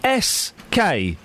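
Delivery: letter by letter, very slowly, drawn out; spelling it out. Shown as a demonstration. Host spelling a word for a caller, slow spelling out. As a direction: spell it slowly, each letter held high. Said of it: "I'm gonna spell a word".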